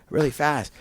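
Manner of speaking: ordinary talk, nothing marked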